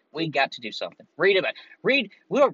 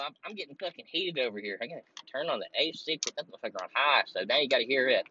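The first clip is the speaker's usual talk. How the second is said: ordinary delivery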